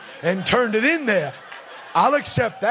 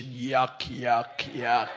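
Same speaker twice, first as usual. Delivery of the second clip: very low, dropped growl of disgust; mock disgust; 12 semitones lower, 8 dB quieter, much slower than usual. Preacher sneering at the state lottery, deep mock disgust. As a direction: spit the word out in distaste. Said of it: "disgust at the lottery".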